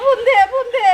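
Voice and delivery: wailing, insistent plea; tearful urging. Acted. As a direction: urge them on through sobs. Injured mother demands a phone call. (কাঁদতে কাঁদতে তাগাদা)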